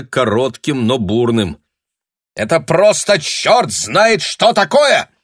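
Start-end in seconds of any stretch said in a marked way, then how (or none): 2.4-5.1 loud, high, slow angry shout. Acted. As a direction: bellow it in outrage. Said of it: "крикнул".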